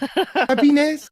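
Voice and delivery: squeaky high put-on voice, a little slow; mock high confession. Host mocking Jen's voice for the chat, high falsetto. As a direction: sing out the silly confession in a high, innocent falsetto. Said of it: "I would say".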